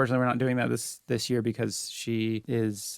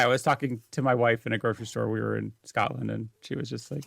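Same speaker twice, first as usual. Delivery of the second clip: usual speaking voice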